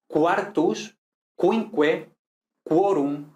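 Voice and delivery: "quartus, quinque, quorum" with kw: KWAR-tus, KWIN-kwe, KWO-rum; U sounded after Q. Shown as a demonstration. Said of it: "en latín sí pronunciamos la U".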